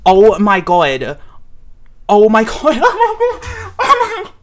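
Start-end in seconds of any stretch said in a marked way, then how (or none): 2.1-4.3 very high, breathless laugh between words; giddy laughing squeal. Real, not acted. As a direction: squeal and giggle in disbelief. Overwhelmed fan, laughing squeal.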